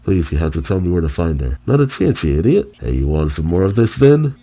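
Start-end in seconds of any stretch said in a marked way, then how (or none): 1.7-2.7 high, mocking sneer; sing-song taunt, pitched high; taunting refusal. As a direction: shut him down with a jeer. Warden mocks the prisoner.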